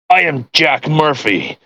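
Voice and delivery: ordinary delivery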